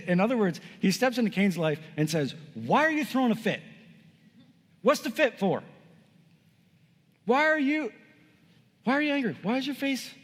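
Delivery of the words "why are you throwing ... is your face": high-pitched, incredulous questions with long pauses; acted exasperation. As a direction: confront him as if baffled by his fit. God challenging Cain's fit, acted.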